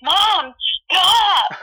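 high, exasperated mock whine; frustrated teen. Younger self protesting at a worrying mom, mock frustration. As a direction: imitate a fed-up kid crying out. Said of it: "I used to get really frustrated".

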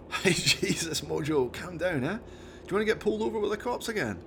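lightly voiced Scottish mate telling off his chimp; a hint of a Scottish lad; Jones, exasperated driver.

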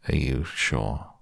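low, slow and slightly soft; doubtful, glum question; Eeyore. A narrator's reading. Donkey doubting he is invited, low and slow.

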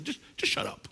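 hushed, voiceless dismissal; whispered shut up. Pretend. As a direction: hiss it off under breath. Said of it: "what I do to the devil".

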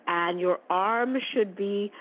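ordinary talk, nothing marked

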